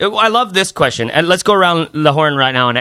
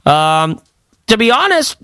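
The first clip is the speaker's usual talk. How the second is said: put-on higher, slow goofy wide-eyed kid voice that climbs higher as it goes; naive college freshman; 4 semitones higher than usual. Mocking his co-host as a wide-eyed eighteen-year-old at the major fair. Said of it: "just like".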